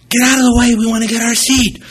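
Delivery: high, loud mock yell; impatient shout. Passengers stuck behind in the aisle, impatient shout. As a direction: holler it like a fed-up crowd.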